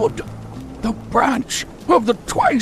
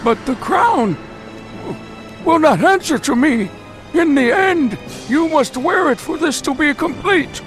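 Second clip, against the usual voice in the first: insistent; 7 semitones higher than usual. Acted. Villain insists on the ritual.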